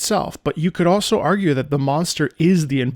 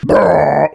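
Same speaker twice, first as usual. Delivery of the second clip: tuba imitation; 5 dB louder than usual. Shown as a demonstration. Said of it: "tuba or the sousaphone".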